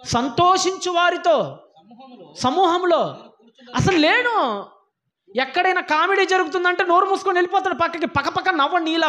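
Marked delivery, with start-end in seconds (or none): 0.1-4.7 slow, weighty recital of the verse; solemn scripture reading. Shown as a demonstration. Preacher reciting Jeremiah's verse to the church, slow and solemn. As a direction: linger on each phrase like holy words. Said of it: "చదవండి మళ్ళా మాట".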